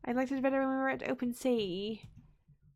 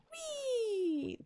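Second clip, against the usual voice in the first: high, soft, drawn-out whee; gliding little squeal of play; playful whee; 11 semitones higher, 5 dB quieter than usual. Pretend. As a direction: let out a long high whee. Playful squeal while playing.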